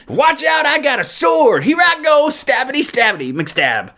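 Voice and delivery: hammed-up high battle chatter; Damian impression. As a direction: cry it out high, then drop on the last word. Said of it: "This is my Damian impression".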